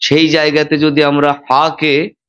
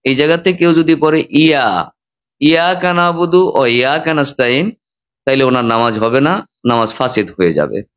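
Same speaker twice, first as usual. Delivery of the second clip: ordinary talk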